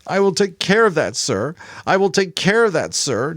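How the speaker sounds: boy mocking the intercom voice cruelly; cruel sarcastic mimicry. A narrator's reading. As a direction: sneer it back, twisting the words. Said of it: "mimicked in a cruel, sarcastic voice".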